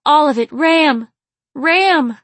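high, very loud climactic cry; cried out. A narrator's reading. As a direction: cry his name out with abandon. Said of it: "she cried".

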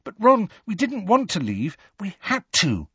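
high-pitched, apologetic pleading; placatory. A narrator's reading. Hermione explains to Ron why they left, placating. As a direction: plead to soothe a hurt friend. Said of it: "stretching out a placatory hand towards him".

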